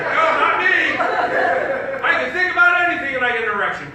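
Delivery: loud, high boast of the cocky guys; mock protest. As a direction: crow it loudly, mocking the braggarts. Mocks boastful guys in audience.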